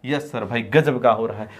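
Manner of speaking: a hint of pupils chiming back; students answering. Teacher answers for his students in a mild class voice.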